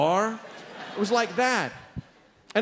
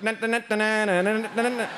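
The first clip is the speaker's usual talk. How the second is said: rapid nasal-consonant "na-na" motor chant; imitated cab noise; 3 semitones higher than usual. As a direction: mimic a cab racing up alongside. Imitates cab pulling up alongside.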